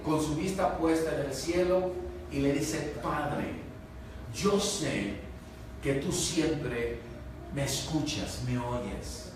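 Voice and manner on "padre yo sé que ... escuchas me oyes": quiet prayer. Retold or imitated. Jesus praying to the Father.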